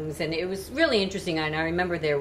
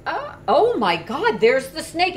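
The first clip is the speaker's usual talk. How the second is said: high, loud, slowed surprise; finally spotting it, startled; sudden discovery; 10 semitones higher, 7 dB louder than usual. Retold or imitated. Daughter finally sees the zoo snake, high and startled. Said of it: "I'm like".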